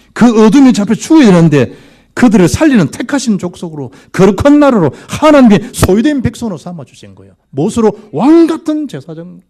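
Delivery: high, loud pulpit proclamation; impassioned preaching. Real, not acted. Preaches at full force. (높고 큰 소리로 열정적인 설교)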